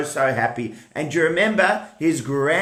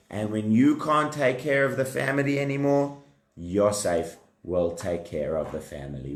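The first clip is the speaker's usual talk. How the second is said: reassuring; 4 semitones lower than usual. Acted. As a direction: reassure gently and steadily, unhurried. Voicing God reassuring Yaakov.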